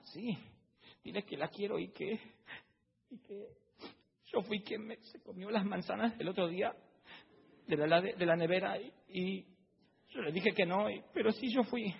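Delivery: voz de niño más aguda, tímida y confesando. Acted. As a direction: como un niño pequeño que confiesa una travesura. Niño confiesa lo de las manzanas. (niño que le confiesa a su padre, voz infantil aguda)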